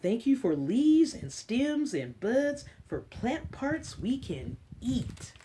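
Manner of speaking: slow storybook read-aloud; reading to children. Acted. Librarian reading an Earth book to kids, storybook voice. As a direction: read it gently to a class of little ones.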